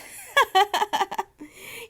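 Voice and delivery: a big rising laugh, put on for the story; acted hearty laugh. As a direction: laugh out loud with the whole family. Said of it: "We all laughed".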